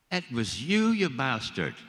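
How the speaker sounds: high, loud accusing cry, played for laughs; hammed-up indignant discovery, leaning on YOU; mock outrage. Co-star finds out at the premiere, mock outraged.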